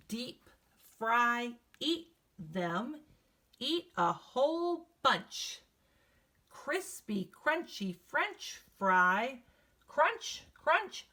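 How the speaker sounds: sing-song chant, slow and lilting; rhythmic read-aloud chant; reading a poem to children. Acted. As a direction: read it like a bouncy rhyme for kids. Teacher reads class poem aloud.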